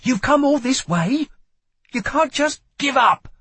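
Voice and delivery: high-pitched shouted plea; urgent shout. A narrator's reading. Lila urging Kel not to give in, shouting. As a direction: shout high, urging them to keep fighting. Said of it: "she shouted as his body spasmed".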